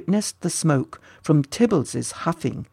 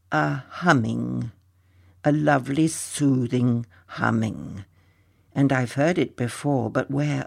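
lightly voiced, slow, softly wistful dragon; dreamy longing; 3 dB quieter than usual. Slow wistful dragon describing the humming to his friend. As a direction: linger on each word like a fond memory.

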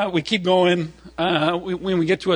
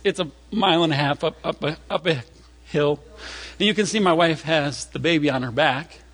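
usual speaking voice

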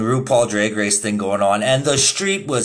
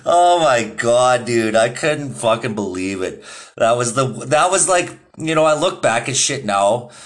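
ordinary talk

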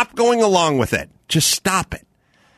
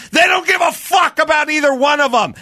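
shouting; 7 semitones higher than usual. Real, not acted. Host ranting about mask rules, angry shout. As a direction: bark the answer at them.

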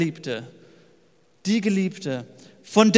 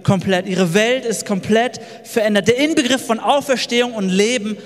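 higher, swelling, triumphant sermon voice; preaching climax; 5 semitones higher than usual. Acted. Preacher telling his congregation of the resurrection, triumphant. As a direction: build to the joy of the resurrection.

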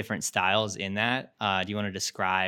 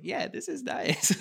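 blissed out; slower than usual. Retold or imitated. Relaxed quote on a drive.

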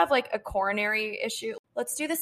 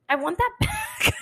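much higher, laughing while whining; laughing mock whine; 10 semitones higher, 3 dB louder than usual. Retelling grabbing back the hundred, laughing whine. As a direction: wail it half-laughing.

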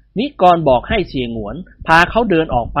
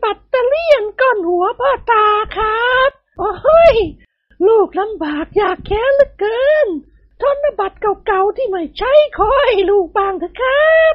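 much higher, pinched whining ghost voice; squeezed tiny voice. Acted. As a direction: squeeze your voice thin and beg pitifully. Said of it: "บีบเสียงพูดให้แหลมเล็ก".

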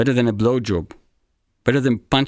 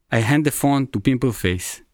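ordinary delivery

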